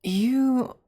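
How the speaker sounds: hesitant crush, lightly voiced; shy awkward classmate; nervous classmate roleplay. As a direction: fumble through inviting a classmate over. Roleplay classmate invite monologue.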